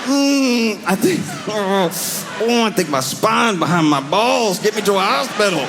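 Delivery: high, pained groaning plea; strained injured plea. Retold or imitated. Crashed leader tells followers his insides moved, pained high plea. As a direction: gasp it out like broken inside. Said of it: "he was like".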